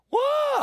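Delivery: very high, loud mock scream; retold child's scream. Scared boy screaming for his granddaddy, high mock shriek. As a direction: shriek "whoa" like a scared kid. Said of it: "I screamed for my granddaddy".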